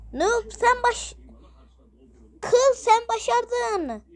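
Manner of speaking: slow, high voiced sidekick; high character voice. Sidekick praising Noob in a high slow voice. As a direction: draw it out in a high cartoon voice.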